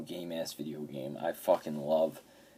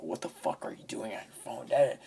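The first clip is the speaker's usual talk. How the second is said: retold yell; 6 semitones higher than usual. Wife yelling at him in hospital.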